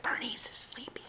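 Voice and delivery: soft whisper; girl whispering beside a sleeping squash. Acted. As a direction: whisper so as not to wake her.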